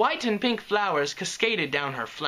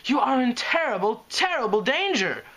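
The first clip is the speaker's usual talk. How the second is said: frightened warning, much higher; tremulous; 9 semitones higher, 4 dB louder than usual. Acted. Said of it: "said tremulously".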